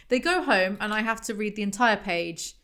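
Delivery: ordinary delivery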